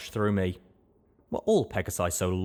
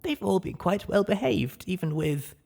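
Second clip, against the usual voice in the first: maid character voice; 11 semitones higher than usual. Acted. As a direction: tell it as the loyal ghoul maid. Maid speaks of the foals.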